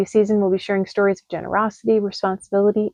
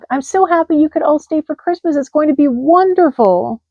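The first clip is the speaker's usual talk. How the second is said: cheerful hedgehog; 7 semitones higher than usual. Acted. Little Hedgehog voiced.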